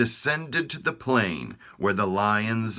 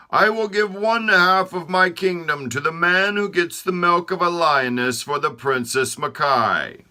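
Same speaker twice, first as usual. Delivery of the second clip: higher, loud proclaiming king; royal decree; 10 semitones higher, 6 dB louder than usual. Acted. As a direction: announce it to the whole kingdom.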